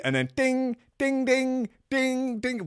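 high sung dings; notification dings. Acted. Phone lighting up with messages, high sung dings. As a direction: chime them like a phone.

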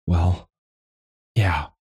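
words forced through a tight throat; barely getting it out; choked out. A narrator's reading. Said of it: "Joa managed to choke out".

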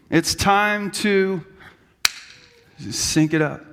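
It's time to sync it up, drawn out slowly; slow take two. Shown as a demonstration. Pastor redoing the line as a take two, slowly. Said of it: "they do take two".

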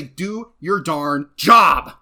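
slow, loud, stern command; mock scolding. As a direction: bark it out at him. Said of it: "beat the pulp out of him and say".

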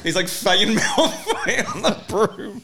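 giggling through the words; laughing. Real, not acted. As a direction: laugh while you say it.

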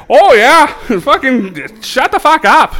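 high, loud mock Wisconsin voice; Wisconsin accent. Guy from Wisconsin answering back, loud Wisconsin accent. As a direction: stretch it out like a hammed-up Wisconsinite, laughing. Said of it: "got the guy from, like, Wisconsin".

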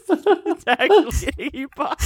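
high, laughing disbelief; amazed. Real, not acted. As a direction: say it like you can't believe it. Amazed repeat while laughing.

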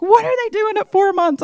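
high, loud retold disbelief; incredulous. New mother reacting to the adoption call, incredulous. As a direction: blurt it out in high-pitched bafflement.

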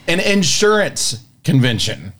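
ordinary talk

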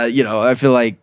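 normal talking voice

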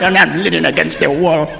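ordinary delivery

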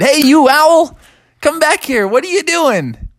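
high, loud bear cub calling after the owl; cross little bear. Acted. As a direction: bear yelling at the owl to come back. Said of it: "And he said".

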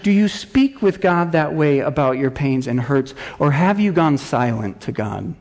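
normal talking voice

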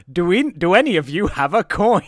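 hammed-up clown asking for a coin; clown voice laughing. Clown asking the kids for a coin, laughing. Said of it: "when he goes".

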